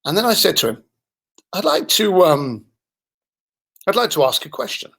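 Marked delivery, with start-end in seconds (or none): 1.5-4.9 measured, hesitant lead-in to a serious question; changed tone. Retold or imitated. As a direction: pause and ask carefully. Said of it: "my tone had changed".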